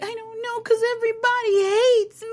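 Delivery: high, whiny fake sob; mock crying. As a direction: wail it like a drunk pity party. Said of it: "And I'm like".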